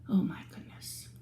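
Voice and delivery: breathy hushed gasp of shock; shocked whisper. Real, not acted. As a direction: whisper it in awe. Shocked aside to children.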